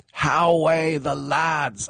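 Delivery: high, drawn-out terrace chant; fans' rallying cry. Retold or imitated. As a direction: call it out long and high like a supporter. Acts out the fans' famous cry.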